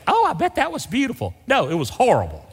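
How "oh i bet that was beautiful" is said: high-pitched mock admiration; gushing somebody. Somebody admiring the snowfall, mimicked high gushing. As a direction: imitate a naive admirer of snow. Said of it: "Somebody said".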